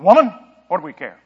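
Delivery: sharp, scornful tone with a punched "Woman"; cold, dismissive tone. Acted. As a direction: read it like a harsh put-down. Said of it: "read this with a tone".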